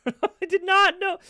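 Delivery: high, loud, drawn-out protest; incredulous denial. Real, not acted. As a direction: squeal your innocence, stretching the words. Insists he had not known.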